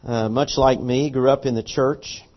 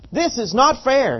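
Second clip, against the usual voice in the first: higher protest; 9 semitones higher than usual. Retold or imitated. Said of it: "he says".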